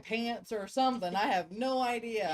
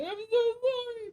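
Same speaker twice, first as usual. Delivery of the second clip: mock crying; 8 dB louder than usual. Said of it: "I feel bad afterwards and I'll cry".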